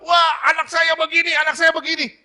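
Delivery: put-on high, loud shouted complaint about a child; exasperated complaint. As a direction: cry out in frustration to God. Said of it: "Kalau saudara bilang".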